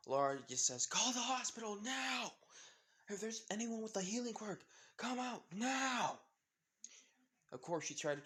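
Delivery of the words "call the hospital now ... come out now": high-pitched cry for help; urgent shout. Acted. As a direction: call out urgently to a crowd, drawing out the last word. Laura urgently calls for emergency help.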